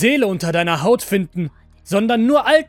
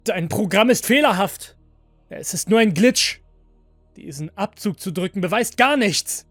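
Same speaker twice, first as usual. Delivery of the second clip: raised, high, insistent voice; urgent protest; 6 semitones higher, 7 dB louder than usual. A narrator's reading. Master disputing an armed android, loud and urgent. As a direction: argue forcefully against it.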